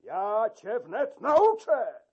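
angry threat. Acted. Key-keeper threatening the usher, loud angry yell. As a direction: threaten to teach him a lesson. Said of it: "wrzasnął klucznik".

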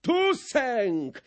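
a mildly acted cry of triumph; cried out. A narrator's reading. The sprinkler cries out to the gentry, a mild cry. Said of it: "krzyknął kropiciel".

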